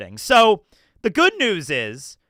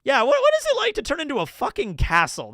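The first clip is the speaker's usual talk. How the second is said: incredulous high voice; 8 semitones higher than usual. Retold or imitated. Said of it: "It'd probably be like".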